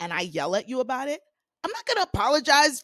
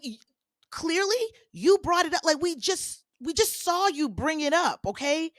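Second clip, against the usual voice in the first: ordinary talk, nothing marked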